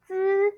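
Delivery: "Z" held high and level; first tone. Shown as a demonstration. Teacher bringing the z part to first tone, high steady "Z". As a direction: stretch the buzzed "Z" on one high note. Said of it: "bring it to first tone".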